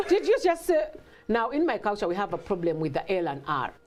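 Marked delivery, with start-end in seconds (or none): 0.1-0.9 high, louder disbelief; can't believe what she heard; incredulous. Retold or imitated. Mother to daughter mocking her accent, high incredulous. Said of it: "I'm like".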